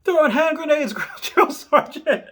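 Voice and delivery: high, loud yell, slowing at the end; screaming back. Retold or imitated. Recruit screams answer to drill instructor, high loud yell. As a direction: shout it back eagerly. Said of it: "the recruit screamed back".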